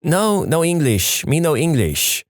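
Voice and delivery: put-on high, slow broken Chinese accent; stumbling imitation of a Chinese accent. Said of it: "my most broken Chinese accent imitation".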